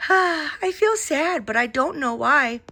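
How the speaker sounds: put-on a boy sighing over his teddy, sad. A narrator's reading. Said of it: "with a great big sigh".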